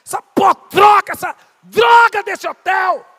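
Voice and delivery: full-force furious shout, high and drawn out; nervous outburst. Retold or imitated. Guest re-enacting his row with the hotel manager, furious shout. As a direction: explode and roar the complaint slowly. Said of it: "fiquei tão nervoso, eu falei assim".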